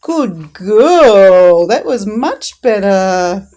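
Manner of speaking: loud, delighted praise, slowing on the last words; excited praise. Real, not acted. Owner praising her dog for taking it gently, loud and delighted. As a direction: gush over the dog's success.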